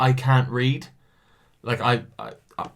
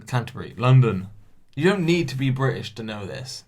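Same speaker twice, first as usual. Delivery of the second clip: ordinary talk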